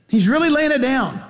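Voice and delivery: ordinary talk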